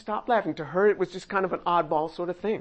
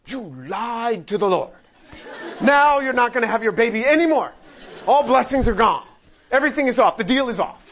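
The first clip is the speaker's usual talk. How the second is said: louder, fast, triumphant scolding; mock gloating God; 2 semitones higher, 4 dB louder than usual. Preacher showing the harsh Lord that God was not, gloating loudly. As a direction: crow over the caught liar. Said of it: "He didn't go".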